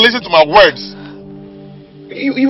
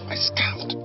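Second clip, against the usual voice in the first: tense whisper, much softer; hushed emphasis; 11 dB quieter than usual. Acted. Husband reminding wife of his scam, angry whisper. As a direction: whisper it as a guilty secret. Secret admitted under his breath.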